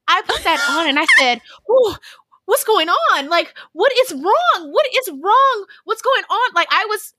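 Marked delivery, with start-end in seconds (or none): 1.7-6.5 high, rising panic at what's wrong; panicked freakout. Retold or imitated. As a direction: sound alarmed, voice climbing. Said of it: "freaking out".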